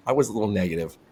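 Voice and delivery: usual speaking voice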